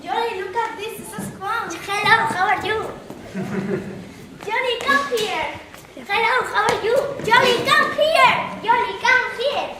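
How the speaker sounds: high squeaky clown voice, loud; playful clown greeting the crowd; silly clown. Acted. Clown act greeting each other.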